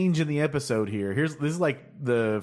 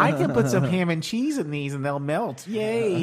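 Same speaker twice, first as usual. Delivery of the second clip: put-on high, excited little kid voice; 7 semitones higher than usual. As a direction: chirp it like a happy child. Voicing little fat Devo.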